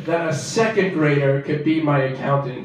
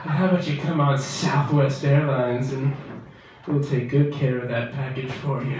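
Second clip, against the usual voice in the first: lightly done sultry insurance pitch; sexy ad voice; 4 semitones lower than usual. Retold or imitated. Comic mocking a seductive State Farm ad.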